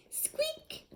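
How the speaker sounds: high whispered squeak; squeaky dolphin whisper. Acted. Dolphin sounds in the story.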